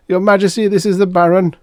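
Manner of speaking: high, loud, pinched court flunky voice; forcing the words out through thin lips; squeezed-out flunky. A narrator's reading. Flunky answering the fake Graf, high, loud and pinched.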